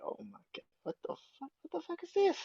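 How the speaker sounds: high squeaky what-the-fuck disbelief; mock disbelief. Fans hearing Pop Your Collar, high stunned squeak. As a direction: shriek it like a stunned fan. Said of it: "People was like".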